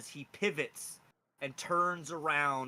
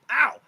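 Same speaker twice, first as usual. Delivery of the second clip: small ow; 11 semitones higher, 16 dB louder than usual. Acted. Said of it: "You kinda just have a small".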